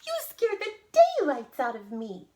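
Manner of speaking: put-on high, loud old-lady cry; hammed-up fright in a high little voice; startled tiny old woman. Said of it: "said the tiny old woman".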